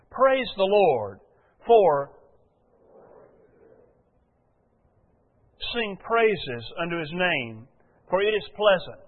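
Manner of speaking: slow, measured scripture reading; preacher reading psalms from the pulpit, slow and measured. Acted. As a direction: read the verse out deliberately to the congregation. Reads scripture aloud slowly.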